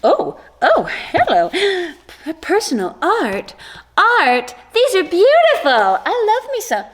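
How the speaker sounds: high, excited gasps of delight; delighted gushing. Real, not acted. Vlogger discovers personal art, delighted gushing.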